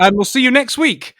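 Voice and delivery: chirpy sign-off from a host who doesn't get it; clueless kids' TV presenter. Retold or imitated. Kids' show host wrapping up before the end credits, chirpy and confused. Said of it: "the presenter, um, was like".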